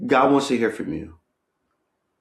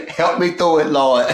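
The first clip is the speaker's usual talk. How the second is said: laughing through the words, higher; 6 semitones higher, 5 dB louder than usual. Real, not acted. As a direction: giggle while pleading. Laughs at own joke.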